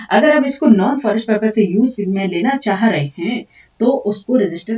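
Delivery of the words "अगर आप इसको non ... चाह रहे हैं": normal talking voice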